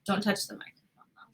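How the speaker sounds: soft whispered warning. Real, not acted. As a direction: whisper so the mic barely hears. Telling Darren to be quieter.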